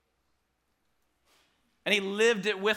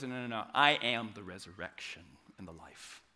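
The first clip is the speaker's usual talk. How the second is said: calm assurance; 8 semitones lower than usual. Pretend. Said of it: "Jesus says".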